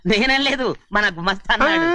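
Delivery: hasty, high-voiced passing of blame; high-pitched denial. Servant disowning gossip to his master, high-pitched denial. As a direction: jump up high to deny it.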